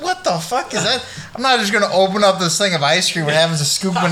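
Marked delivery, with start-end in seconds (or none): 0.0-1.1 retold disbelief. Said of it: "I'm like".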